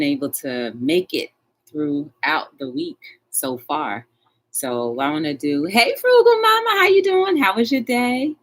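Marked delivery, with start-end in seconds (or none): 5.6-8.4 much higher and louder sing-song hello; high greeting. Real, not acted. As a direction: sing out a welcome to a viewer. Greets viewer joining live chat.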